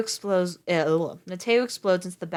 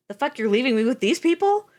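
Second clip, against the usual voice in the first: outraged; 7 semitones higher, 7 dB louder than usual. A narrator's reading. Mateo outraged at being left.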